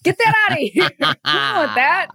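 high, laughing mock disgust; mock outrage. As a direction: shoo it away in playful outrage.

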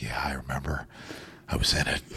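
low, whispered rasp of a reply; barely voiced, soft and low; Clint Eastwood. Film star answering a fan, Clint Eastwood impression. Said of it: "Clint Eastwood".

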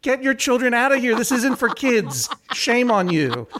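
indignant shout, held back in volume; retold outraged yell. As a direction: re-enact an indignant public scolding. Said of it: "I stood up in the theater and I yelled".

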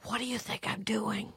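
breathy unvoiced whisper; whispered retort. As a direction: hiss it under your breath. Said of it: "And Kara's like".

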